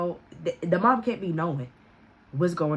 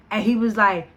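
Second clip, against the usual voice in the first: ordinary talk, nothing marked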